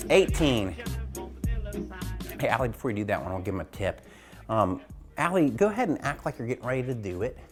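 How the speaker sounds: ordinary delivery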